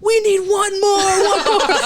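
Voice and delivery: high, loud mock rallying call; imitated crowd plea. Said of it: "So they'd be like".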